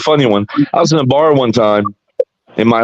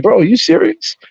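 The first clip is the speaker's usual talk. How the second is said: much higher, incredulous question; retold disbelief; 9 semitones higher than usual. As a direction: challenge him in high-pitched disbelief. Acted incredulous retort to redneck.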